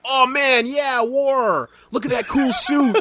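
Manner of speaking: put-on high, excited little boy; excited kid voice. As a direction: gush like a kid seeing a war. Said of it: "through the lens of some kid that's like".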